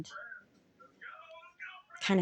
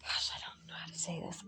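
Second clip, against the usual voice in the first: whisper; 11 dB quieter than usual. Real, not acted. Overwhelmed mid-reading, lost for words.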